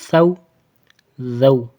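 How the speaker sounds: "سو" and "زو" as lisped th sounds; tongue tip between the teeth for "سو", "زو". Shown as a demonstration. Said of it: "ان دونوں دانتوں کے درمیان رکھنی ہے".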